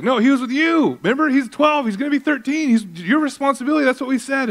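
high, insistent mock scold of the husband; Mary scolding. Mary chides Joseph for losing Jesus, high mock scold.

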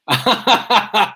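high-pitched burst of laughter; laughing. Real, not acted. Storyteller amused by his broken-down Falcon, high laugh. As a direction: crack up at your own story.

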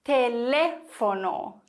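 Teléfono slowly, with a long stressed LÉ; stress on second syllable. Shown as a demonstration. Teacher demonstrates the Spanish word, stressing LÉ in Teléfono. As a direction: stretch the LÉ in Teléfono, fono falling away. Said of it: "nämlich auf dieser Silbe".

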